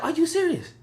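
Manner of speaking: very high stunned question; taken aback by the insult; shocked disbelief. Retold or imitated. Cursed out in the parking lot, very high shocked question. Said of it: "I was like".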